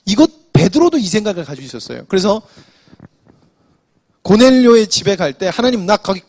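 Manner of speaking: ordinary delivery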